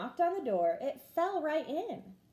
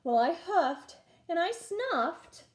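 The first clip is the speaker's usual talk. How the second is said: slow huffing; 5 semitones higher, slower than usual. A narrator's reading. Wolf huffs before sneeze.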